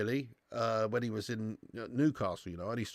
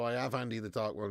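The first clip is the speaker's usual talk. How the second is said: ordinary delivery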